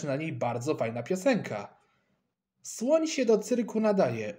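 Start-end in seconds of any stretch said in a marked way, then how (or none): 2.6-4.4 high-pitched happy exclamation; light up with joy, pitched well up; delighted. A narrator's reading. Kleofas welcomes an elephant for the circus, delighted. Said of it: "ucieszył się Kleofas".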